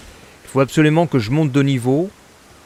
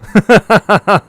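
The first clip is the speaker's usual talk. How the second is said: loud laugh; 8 semitones higher, 10 dB louder than usual. Real, not acted. Gleeful laugh after threat.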